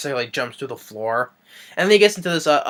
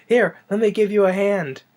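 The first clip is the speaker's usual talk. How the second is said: higher, louder sneering villain voice; sly Joker offer; 9 semitones higher, 5 dB louder than usual. Retold or imitated. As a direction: offer the hand like a grinning trickster. Joker's fake hand gag.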